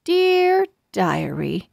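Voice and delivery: plain reading voice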